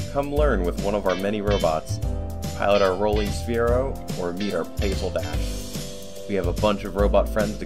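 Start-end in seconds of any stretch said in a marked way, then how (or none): none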